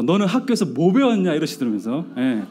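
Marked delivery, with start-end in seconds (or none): 0.0-1.3 raised-pitch retold scolding; sudden anger. Father to son over math question, mock sudden anger. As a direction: imitate a father suddenly flaring up. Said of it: "갑자기 화를 버럭 내시면서".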